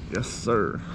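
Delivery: ordinary talk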